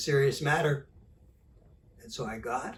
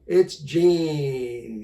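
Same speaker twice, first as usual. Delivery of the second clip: a touch of North Carolina drawl, a bit louder; 3 semitones higher, 10 dB louder than usual. Retold or imitated. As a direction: drop lightly into a North Carolina drawl to voice the caller. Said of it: "he said with his North Carolina drawl".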